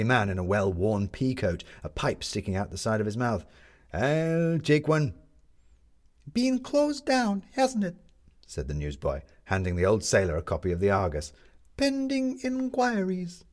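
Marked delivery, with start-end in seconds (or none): 3.9-5.1 slow, a hint of an old sailor; unhurried old seaman buying a paper; elderly man in a pea coat buying a paper, slow. A narrator's reading. Narrator voices old sailor. 6.3-8.0 put-on high, slow boy's voice; chirpy paper boy; newsboy answering the old sailor, high and slow. A narrator's reading. 11.8-13.4 high, slow street-hawker cry; newsboy crying the headline to passing crowds; newsboy shouting. A narrator's reading. Newsboy relishing phrase.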